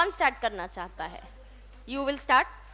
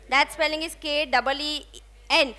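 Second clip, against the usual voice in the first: ordinary talk, nothing marked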